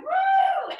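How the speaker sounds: high, loud whoop like gusting wind; windstorm woo. Real, not acted. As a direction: whoosh up like a spring wind. Windstorm, cheering.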